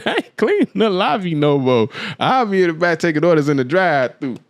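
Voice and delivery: tossing his old boss a cheeky refusal, lightly played; defiant retort.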